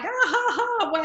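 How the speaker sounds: high-pitched mock laugh; imitated laughing. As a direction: mimic Dad cracking up, high. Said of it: "he would be laughing like".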